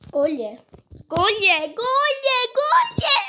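plea climbing into a yell; building plea. Real, not acted. Repeats plea, rising each time.

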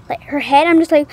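ordinary delivery

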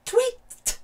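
very high piercing chirp; sudden high canary peep; bird tweet. A narrator's reading. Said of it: "made a strange noise".

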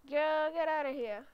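loud, pushy get outta here; loud shoo. Pretend. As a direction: chase her out loudly. Ordering girl out of car.

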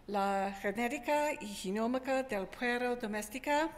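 Spanish with a real American accent; careful Spanish from an English speaker. Real, not acted. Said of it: "mi español no es muy bueno".

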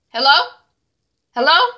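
loud, high, rising check; calling into phone. Retold or imitated. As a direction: call out to a dropped line, annoyed. Caller hung up on T Y.

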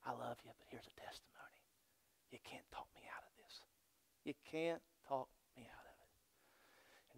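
hushed whisper, slowing at the end; soft whisper. Retold or imitated. Preacher voicing a believer answering a doubter, soft whisper.

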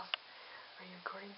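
hushed whisper. Real, not acted. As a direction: whisper it so mom won't hear. Hiding from mom, whispering.

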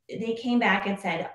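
ordinary talk, nothing marked

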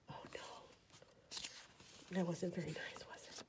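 hushed guilty aside; whispering. Acted. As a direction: whisper it behind your hand.

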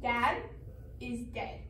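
low, quiet and halting; hushed, painful statement; subdued, halting. Acted. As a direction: say it softly, pausing after Dad. Grieving sister states the loss.

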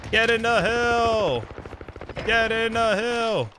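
high, stretched-out holler; urgent calling out. Real, not acted. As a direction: call it slowly on a high held note. Urging, called out twice, drawn out.

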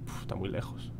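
hushed, breathy whisper. Real, not acted. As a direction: say it under your breath. Whispering through his own turns.

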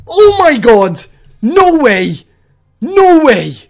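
loud, falling cries of disbelief; loud amazement. Real, not acted. Streamer reeling from a lucky find, loud amazement.

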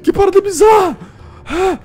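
high, loud panicked cries near sobbing; real terror spilling out as shouts; terrified shrieking. Real, not acted. Player finding a creature in the drawer, shrieking in terror.

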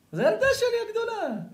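very high, indignant mock insistence; hammed-up protest. Preacher plays the liar claiming his eldest daughter, protesting shrilly high. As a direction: overact a liar's shocked self-defense. Acts the man's protesting lie.

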